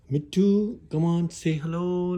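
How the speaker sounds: high, singled-out call to a pet; coaxing a pet. Real, not acted. Calling her pet to talk.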